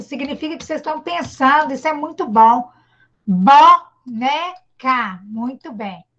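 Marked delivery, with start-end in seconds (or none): 3.3-5.2 slow clapped syllables, a bit lower; slow teacher demo again. Shown as a demonstration. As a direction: repeat bo-ne-ca slowly for counting. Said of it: "gosta de ver vocês fazendo assim".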